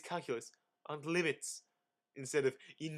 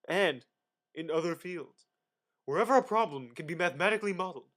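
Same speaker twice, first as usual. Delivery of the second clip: ordinary talk, nothing marked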